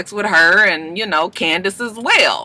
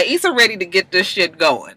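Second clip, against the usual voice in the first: normal talking voice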